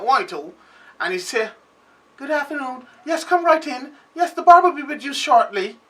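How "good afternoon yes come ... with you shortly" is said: put-on English or American accent, pitched up; mock-polite English accent for the tourists; posh English accent. Cursing man turned sweet to greet tourists, put-on English accent. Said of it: "put on the prettiest English accent or American accent".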